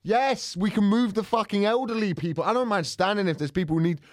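rising up in mock outrage; heated rant. Real, not acted. Heated rant about train.

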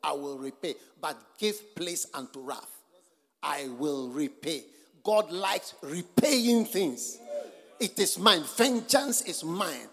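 drawn-out, weighty words of God; preacher slowly voicing God's promise; slow solemn scripture. Real, not acted. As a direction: let each word of vengeance land slowly.

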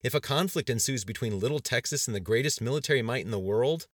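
derisive, rising question; mocking. A narrator's reading. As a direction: scoff at them, pitch and volume up. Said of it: "Terry Markison mocked them".

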